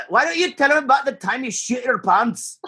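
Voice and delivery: high, hammed-up Scottish accent; Mike Myers Scottish accent. Quoting So I Married an Axe Murderer in a high mock Scottish accent. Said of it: "the best Scottish thing I can do".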